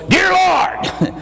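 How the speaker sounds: man just saved shouting his first prayer like a drill sergeant. Retold or imitated. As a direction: bark Dear Lord out like a drill sergeant on parade. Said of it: "He was a drill sergeant".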